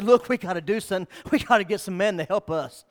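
a touch of worried apostle; apostles' worried plea. Retold or imitated. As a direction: voice the overwhelmed apostles, quick and hushed. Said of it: "the apostle said".

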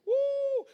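sudden high whoop of joy; excited whoop. Real, not acted. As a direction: let out a joyful high woo. Preacher's excited cry.

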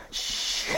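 bossy mock shushing; pouty shush. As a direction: shush like the bossy kid in line. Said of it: "have that pouty looking face".

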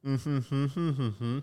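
humming through sour powder; eating sour candy. Real, not acted. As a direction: tight hums with a mouthful. Dry scooping sour powder.

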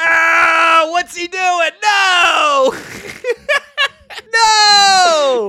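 very high, loud drawn-out screams; shocked wailing. Real, not acted. Player reacting to the silly new Pokemon, screaming in disbelief. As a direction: wail in comic horror at the sight.

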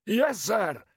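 high, emphatic acknowledgement; saluting troll sergeant. A narrator's reading. Troll sergeant acknowledges his commander, emphatically. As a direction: snap out the response to an order.

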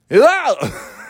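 high-pitched imitated cry of pain; mock pained yelp. Mimicking kid hit by ball, mock yelp.

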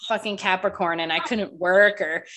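ordinary talk

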